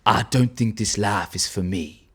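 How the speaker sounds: deep hushed bounty hunter line; Boba Fett accent. Shown as a demonstration. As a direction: sink the voice low and quiet like Boba Fett. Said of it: "I'll do it in the accent".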